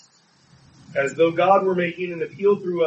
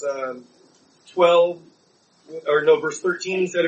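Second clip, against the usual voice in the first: ordinary talk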